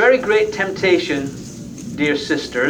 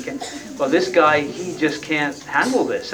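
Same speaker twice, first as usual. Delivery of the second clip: ordinary delivery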